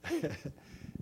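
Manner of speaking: brief laugh in mid-testimony; chuckle. Real, not acted. Laughs during his testimony.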